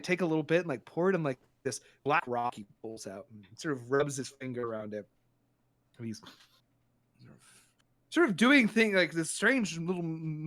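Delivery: ordinary talk, nothing marked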